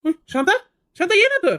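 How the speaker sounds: high, loud panicked calling of Shanta; alarmed shout. Acted. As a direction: cry out her name in sudden fear. Wife collapses, alarm.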